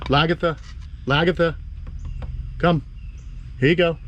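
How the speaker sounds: high sing-song pet call. Real, not acted. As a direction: call her in, bright and high. Calling lizard.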